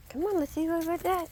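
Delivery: high, fast coaxing to the dog; high quick encouragement; urging the dog. Real, not acted.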